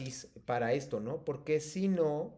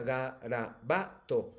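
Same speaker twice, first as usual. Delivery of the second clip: slow syllable-stress demo, garabato stretched with the stress on ba; stressed syllable drawn out; much slower than usual. Shown as a demonstration. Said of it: "eso solo se logra repitiendo en, lo, en voz alta".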